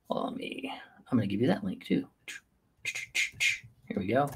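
a breathy whisper to himself while he hunts for the link; whispered aside. Real, not acted. Streamer whispering to himself while finding the podcast link, hushed. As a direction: drop to a whisper, half to yourself.

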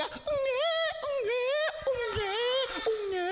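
high-pitched mock newborn wail; squealed pretend infant bawling; imitated baby crying. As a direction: imitate a baby's repeated cries. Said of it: "이렇게 우는 소리가 들릴 거야".

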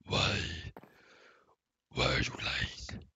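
slow hushed accusation; mock accusing whisper. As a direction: whisper at chat like they betrayed you. Accusing chat of lying.

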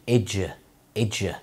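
"إج" as a clipped syllable ej; j sound. Shown as a demonstration. Teacher isolating the sound, "إج" with j. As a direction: sound out "إج" low, ending in j. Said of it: "ال دي مع يو بتدي صوت ج".

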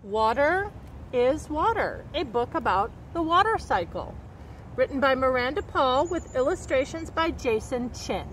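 slow, lilting picture-book read with long pauses before each ending; reading to children. A narrator's reading. Nature educator reading a water cycle book to kids, in a slow storytelling voice. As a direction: savour each rhyme like a storyteller at circle time.

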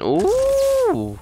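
very high startled ooh; surprised squeal. Real, not acted. Player startled by a noise in game, high squeal. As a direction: shoot up into a squeal.

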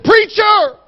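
high, loud, desperate shout; imitated scream. Frantic father screaming to the pastor on the phone, imitated scream. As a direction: cry out down the phone for help. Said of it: "he's screaming".

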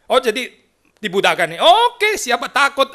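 high, loud, gung-ho mock voice; hammed-up bravado, rising to a shout; mock eager preacher. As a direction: play it as a cocky, eager shrug. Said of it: "Yesaya bukan pergi kan".

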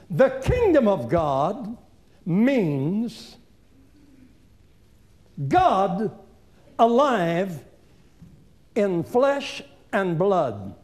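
slow, deliberate phrasing with long pauses; slow emphasis. Real, not acted. Preacher slowly unpacking the verse. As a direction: lay each word down slowly.

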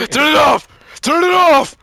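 loud, high-pitched panicked command; frantic high shout; urgent shouting. Pretend. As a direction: yell it like an emergency. Child in danger in game.

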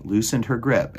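ordinary delivery, nothing marked

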